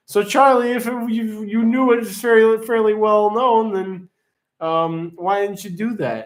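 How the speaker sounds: high playful mock accusation; teasing. Real, not acted. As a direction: poke fun at the viewer. Teasing viewer who knew answer.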